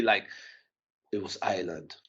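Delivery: ordinary talk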